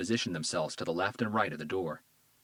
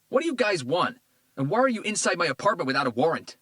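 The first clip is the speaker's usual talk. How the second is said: higher, louder defiant shout; hostile shout; 10 semitones higher, 6 dB louder than usual. A narrator's reading. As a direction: spit it at the cop. Tito confronts cops.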